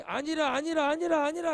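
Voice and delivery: high, loud mocking no no no; mocking Russia insisting it is not so; mock denial. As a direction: parody a stubborn denial. Russia's denial mocked in quote.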